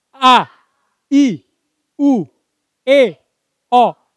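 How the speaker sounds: high, loud, separate vowel calls; big loud vowels. Shown as a demonstration. Trainer demonstrating articulation drill, loud exaggerated vowels. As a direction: belt out each vowel with a pause between. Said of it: "Yang, yang besar suaranya".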